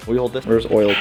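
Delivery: usual speaking voice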